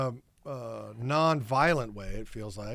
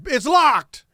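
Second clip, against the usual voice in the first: mock triumphant shout, pitched high; loud announcement; 12 semitones higher, 10 dB louder than usual. Actor retelling his exit line to the crew, loud proclamation.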